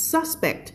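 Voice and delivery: "suspect" as SUS-pekt, front-stressed; stress on first syllable. Shown as a demonstration. Teacher explaining the noun, "suspect" stressed SUS-pekt. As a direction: punch the front of "suspect", let "pect" fall away. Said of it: "มา stress ข้างหน้า".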